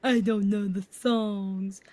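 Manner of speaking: slow, drawn-out complaining whine; whiny boyfriend mimic. Girlfriend mimics boyfriend sulking at the movie. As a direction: mimic his sulky complaint. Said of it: "He was like".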